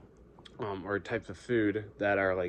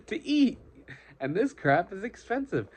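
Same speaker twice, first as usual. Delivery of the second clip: exasperated rant; 9 semitones higher, 3 dB louder than usual. Real, not acted. Grown man ranting about prices.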